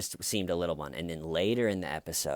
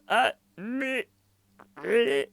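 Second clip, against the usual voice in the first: high, loud squeaky mock sobs; badly faked crying squeals; fake cry; 6 dB louder, slower than usual. Girl trying to cry on cue, high fake sobs. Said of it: "she does this cry thing".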